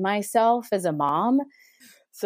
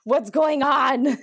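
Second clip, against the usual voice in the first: awkward dad; 7 semitones higher, 3 dB louder, slower than usual. Retold or imitated. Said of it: "remember my dad sitting there very awkwardly".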